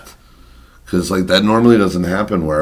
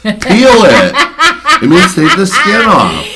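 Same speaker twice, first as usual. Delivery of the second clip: laughing, high and loud; cracking up explaining to the co-host; laughing exasperation; 12 semitones higher, 7 dB louder, slower than usual. Real, not acted. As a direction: spell it out while cracking up.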